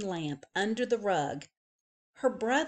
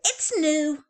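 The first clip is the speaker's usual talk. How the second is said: put-on higher little-girl voice; childish chirp; 7 semitones higher, 5 dB louder than usual. A narrator's reading. Little girl explaining to her mother, high and bright. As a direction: present it as news.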